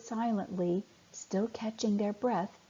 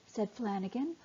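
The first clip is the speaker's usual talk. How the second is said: ordinary delivery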